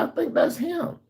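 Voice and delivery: high voiced neighbor lady; imitating the mother. Neighbor mother identifying the boy, high mimicking voice. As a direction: mimic her in a high, light voice. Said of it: "She was like".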